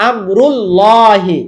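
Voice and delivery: আমরুল্লাহি slow with a thick dark L; slow recited আমরুল্লাহি; heavy L in Amrullahi. Shown as a demonstration. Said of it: "র পেশ help করলো".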